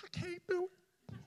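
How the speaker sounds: high, soft squeal of refusal; mock protest. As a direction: act out a squeaky little refusal. Retells refusing to bear the casket.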